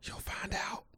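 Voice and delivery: hushed, voiceless confidential aside; whisper. Real, not acted. Host hints to listeners so nobody overhears, whisper. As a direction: whisper it like a secret. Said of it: "he just whispered that to y'all".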